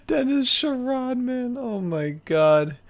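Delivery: high-pitched, tickled disbelief; amused. Real, not acted. Co-host cracking up at a silly name, amused. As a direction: say it through helpless amusement, high.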